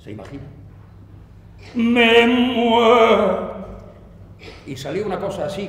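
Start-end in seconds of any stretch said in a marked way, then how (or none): 1.7-3.5 declamación muy lenta, fuerte y sostenida; cóncavo y solemne. Shown as a demonstration. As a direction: alarga cada sílaba como un órgano de iglesia. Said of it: "cóncava y solemne, orgánica". (voz solemne y orgánica, a pleno volumen)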